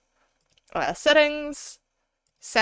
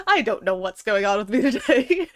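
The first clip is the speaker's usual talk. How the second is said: laughing; 2 semitones higher than usual. Real, not acted. Laughing at own word mix-up.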